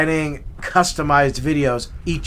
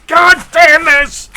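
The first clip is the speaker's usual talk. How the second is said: high loud slow snarl; angry cursing; 12 semitones higher, 11 dB louder, slower than usual. Retold or imitated. Santa behind the scenes, angry swearing. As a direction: curse under the costume. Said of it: "I'm over here like".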